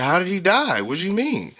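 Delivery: high, pleading, shocked retold question; a friend retelling asking how he died, shocked and high. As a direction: ask it reeling from the news, voice high. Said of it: "I said".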